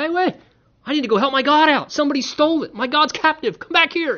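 high, loud, fast frantic cry; mock panicked idol owner. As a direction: chase your stolen god in comic panic. Mocking someone chasing stolen god.

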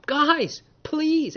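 put-on pleading high and thin, like a scared teenager; imitating a desperate boy's plea; begging plea. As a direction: beg them to stop. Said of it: "like any seventeen-year-old saying".

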